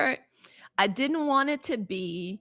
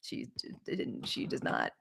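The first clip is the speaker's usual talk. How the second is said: whispering; 10 semitones lower, 9 dB quieter than usual. Retold or imitated. Quoting therapist, voice drops.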